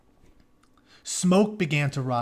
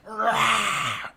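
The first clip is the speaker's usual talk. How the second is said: battle roar; 6 semitones higher than usual. Retold or imitated. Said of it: "So he's like".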